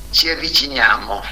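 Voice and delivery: ordinary delivery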